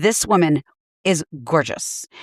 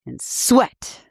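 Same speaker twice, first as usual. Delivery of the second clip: slow, drawn-out dance-teacher pronouncement; Debbie Allen; slower than usual. Pretend. Instructor warning her dance class, slow and commanding. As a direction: lay down the law to hungry students, slowly. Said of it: "I get to play Debbie Allen".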